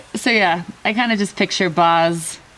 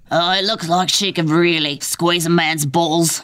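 Boz's voice. Acted. Boz sizing up Tina.

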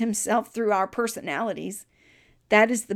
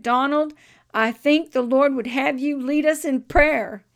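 raised, higher calling voice; loud call to Donald; 5 semitones higher, 6 dB louder than usual. Retold or imitated. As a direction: call out to the boy across the church, loud and high.

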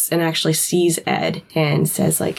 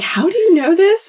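high Dean voice; 13 semitones higher than usual. Retold or imitated. Dean quoted, high pitched.

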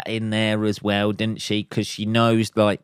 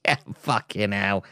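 ordinary talk

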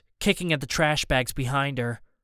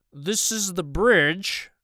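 slow, higher-pitched radio voice with a put-on accent; captain's slow, put-on radio voice; strong and slow; 4 semitones higher, much slower than usual. A narrator's reading. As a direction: stretch it out, strong and deliberate. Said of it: "strong and slow".

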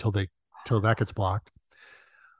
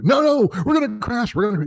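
mock panic; 10 semitones higher than usual. Head writer acting out the nervous passenger in mock panic. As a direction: shriek it like a terrified flyer. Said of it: "keeps coming up and saying".

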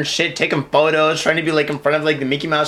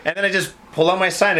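normal talking voice

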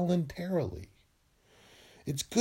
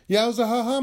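excited; 6 semitones higher, 4 dB louder than usual. Acted. Cheery show catchphrase.

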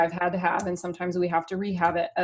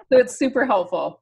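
normal talking voice

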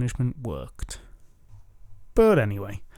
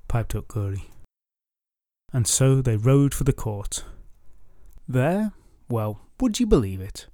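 plain reading voice

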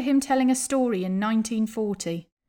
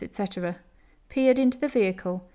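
ordinary delivery

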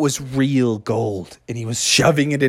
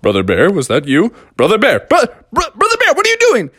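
puzzled call building to high panic; alarmed Father Frog; 8 semitones higher, faster than usual. Acted. Father Frog catching Brother Bear, rising alarm. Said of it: "Father Frog was like".

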